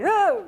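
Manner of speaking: loud, high recoiling "ooh"; startled ooh. Acted. Bunny touches the snake and jumps back, startled. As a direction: yelp an ooh as if touching something nasty.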